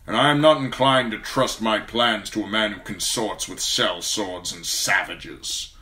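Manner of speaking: cold, measured lord; calm, icy authority; Lord Tywin's voice. A narrator's reading.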